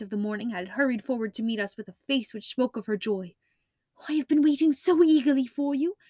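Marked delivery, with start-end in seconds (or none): 4.1-6.0 higher, louder eager welcome; eager cry. Acted. Miss Stoner eagerly greets Holmes, her voice raised high. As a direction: rush out the relief at seeing them. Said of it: "she cried".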